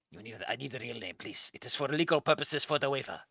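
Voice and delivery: soft, low mock-Japanese old man; fake Japanese accent. Old man wants name for the waiver, fake Japanese accent. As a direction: quietly press in a put-on Japanese accent. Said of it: "end your fake Japanese accent on".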